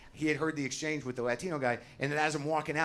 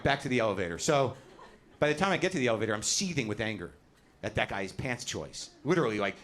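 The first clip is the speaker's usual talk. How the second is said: ordinary talk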